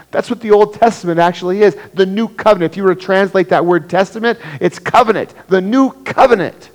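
high, loud, building sermon cry; preaching at full force. Acted. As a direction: drive the point home to the church. Preaching climax.

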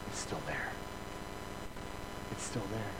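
soft, hushed and awed; a hushed aside to the congregation; hushed awe. Real, not acted. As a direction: let it sink in quietly. Awed repetition in sermon.

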